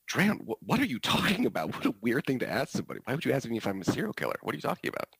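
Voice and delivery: high-pitched, baffled protest; acted incredulity. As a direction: play a flustered teacher, taken aback and talking fast. Teacher acts out baffled reply.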